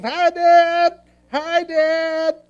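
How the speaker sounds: put-on high, loud, happy child calling out; a little boy shouting to his dad from the field; excited kid.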